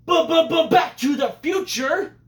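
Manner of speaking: high, loud stuttered build into a shout; excited shout. Real, not acted. Vlogger shouting the big movie title to viewers, excited high shout. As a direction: belt the title like a fanfare.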